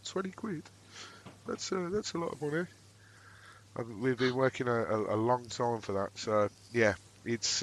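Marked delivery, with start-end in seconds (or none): 0.1-2.6 high, hushed mock disbelief; mock thrifty northerner. Northerner balking at ticket prices to the southerners, in a high, hushed mock voice. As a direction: play the cash-strapped northerner, small high soft voice. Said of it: "us up north, we're like".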